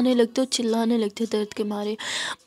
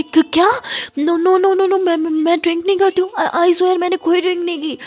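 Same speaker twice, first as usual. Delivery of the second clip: high, loud, frantic denial; scared girl insists she never drank, higher and louder; panicked denial; 7 semitones higher, 6 dB louder than usual. Acted. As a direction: protest her innocence in a frightened rush. Girl denies drinking in panic.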